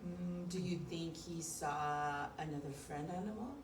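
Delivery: ordinary talk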